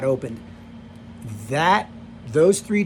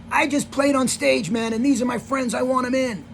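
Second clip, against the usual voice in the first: raised-pitch mock rocker demand; imitating Tommy insisting; 8 semitones higher than usual. Tommy telling the arena guard to let friends in, imitated high insistence.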